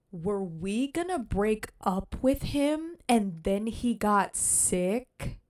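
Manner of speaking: slow, stunned question; shocked realization. Real, not acted. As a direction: let the realization sink in slowly. Gasping realization about breakup.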